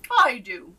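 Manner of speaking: loud eager answer; duke calls out. A narrator's reading. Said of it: "cried the duke".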